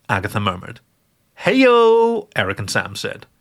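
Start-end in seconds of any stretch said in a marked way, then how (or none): 1.4-2.3 loud, high heyo; two friends cheering a dirty joke. Acted. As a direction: whoop it like a crowd at a joke.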